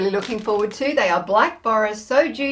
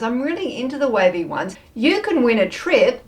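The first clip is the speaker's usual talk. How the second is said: ordinary talk, nothing marked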